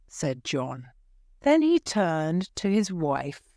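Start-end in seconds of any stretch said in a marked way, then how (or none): none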